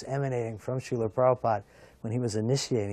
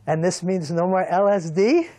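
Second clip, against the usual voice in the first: retold with a laughing lilt, higher and louder; laughing question; 7 semitones higher, 8 dB louder than usual. Guru jokingly asks the initiate about LSD, laughing. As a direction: tease it through a chuckle. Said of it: "Srila Prabhupada laughed and he said".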